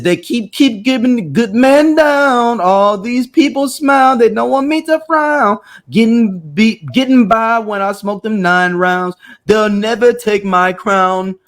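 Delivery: slow, half-sung Jamaican patois; halfway Jamaican patois. Acted. Reading the lyrics so people could know, halfway Jamaican accent. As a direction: read lyrics out in a shaky-attempt Jamaican accent. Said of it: "was that your Jamaican accent?".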